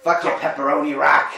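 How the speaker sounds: loud Brooklyn tough guy; a bit of rough Brooklyn; Brooklyn accent. Pretend. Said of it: "We do a Brooklyn accent".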